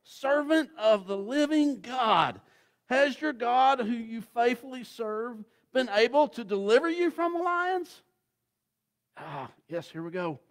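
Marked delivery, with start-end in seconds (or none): 0.0-8.0 high, loud, desperate calling out; king calling anxiously into the lions' den, far higher and louder; anxious cry. A narrator's reading. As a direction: cry out to Daniel in fear for his life. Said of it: "he cried out anxiously".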